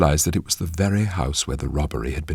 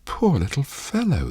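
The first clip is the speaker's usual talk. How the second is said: put-on high young girl's voice, full of pity; a tender girl's high "oh" of pity; pitying exclamation; 13 semitones higher than usual. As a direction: cry out over the hurt boy in a high girl's voice. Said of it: "the girl exclaimed".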